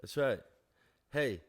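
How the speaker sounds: usual speaking voice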